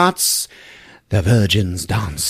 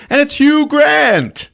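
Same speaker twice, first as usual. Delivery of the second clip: excited reveal; 6 dB louder, slower than usual. Retold or imitated. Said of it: "she goes to answer the door".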